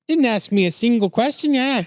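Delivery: high-pitched hammed-up Canadian voice; attempted Canadian accent. Reader quoting the woman on police, in a badly faked Canadian accent. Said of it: "I can't do Canadian".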